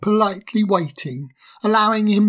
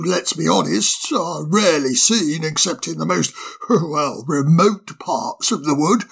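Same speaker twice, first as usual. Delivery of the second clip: a genial owl putting the guest at ease; wise old owl. Acted. Old owl reassures the fire sprite, kindly. Owl's voice held.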